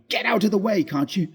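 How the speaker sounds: loud frantic shout; panicked shout. Acted. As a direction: yell at the figure to get out of the way.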